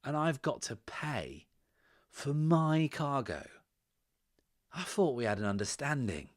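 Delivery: slow, swelling outrage in phrases split by pauses; aggrieved drunk merchant. Acted. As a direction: complain loudly, leaning hard on key words.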